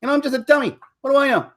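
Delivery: high, loud mock self-deprecating comic; hammed-up modest comedian voice; Bill Burr. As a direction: shrug it off like a fake dummy. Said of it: "he goes".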